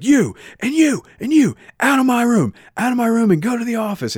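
high, loud furious orders; teacher throwing kids out, angry. Retold or imitated. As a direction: kick the kids out like a fuming teacher. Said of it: "really angry".

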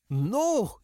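high, sharp, defiant no; barked no. Acted. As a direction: bark it at him. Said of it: "Sindra barked".

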